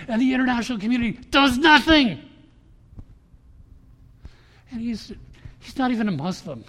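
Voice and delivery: loud, high, urgent preaching; rally the room to fight; fiery loud sermon; preaching at full force. Real, not acted.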